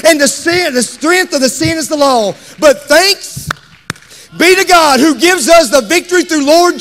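loud, high, triumphant scripture cry; fervent pulpit shout; full-force preaching. Real, not acted. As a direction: ring it out to the whole church.

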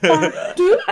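high, rising mock-Scottish "two"; mock Scottish accent. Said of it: "trying to do a Scottish accent as two".

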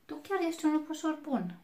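high, quick, sweet praise from a grandmotherly lady; kind old lady. A narrator's reading. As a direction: praise the little wolf in a high, bright granny voice. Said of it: "i-a spus doamna Boggins".

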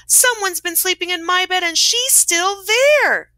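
high little bear, drawn out at the end; exclaiming Baby Bear. A narrator's reading. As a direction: squeal it in outrage as Baby Bear. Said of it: "exclaimed the Baby Bear".

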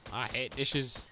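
normal talking voice

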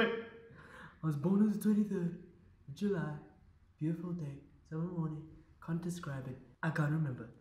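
hushed, falling delivery; soft reverie. Acted. Wistful restart of the same answer.

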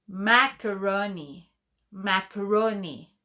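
"macaroni" drawn out, each syllable separate; slow dictation. Shown as a demonstration. Teacher dictates macaroni slowly to pupils. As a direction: stretch out "macaroni" for writing. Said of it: "dictation word said slowly".

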